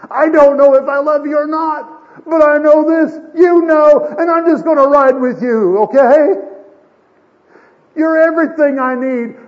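high-pitched, full-force desperate shout; Peter's anguished outburst. Acted. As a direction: cry it out at the top of your voice. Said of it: "listen to the tone there".

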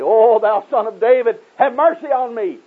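high, drawn-out pleading cry; slow, insistent cry for mercy, pitched high. Retold or imitated. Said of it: "he cried the more loudly".

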